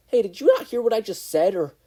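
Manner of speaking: high, loud, fed-up yell; exasperated shout. Acted. Katsuki yelling at his conscience, exasperated. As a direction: bark it at the voice in your head.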